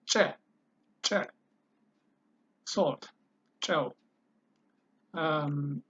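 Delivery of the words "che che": "Che" hit strong and exploded off the palate; explosive CHE. Shown as a demonstration. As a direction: explode each short "che" from mid-mouth. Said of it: "you hit it strong and explode it".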